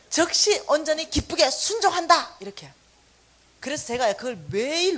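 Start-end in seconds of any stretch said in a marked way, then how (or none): none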